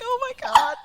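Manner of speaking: high, loud "oh my God" in a put-on voice; shocked gasp. Recalling her reaction to the dog's "I love you", a high, loud mock shock. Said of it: "I was like".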